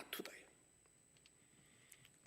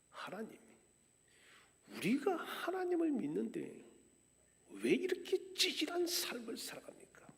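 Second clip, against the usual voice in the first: protesting to God; 6 semitones higher than usual. Retold or imitated. Exiles in Babylon confronting God, indignant protest. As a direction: demand answers, hurt and indignant. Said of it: "하나님께 따졌습니다".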